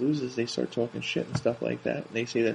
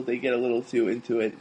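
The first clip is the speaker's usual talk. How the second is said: ordinary delivery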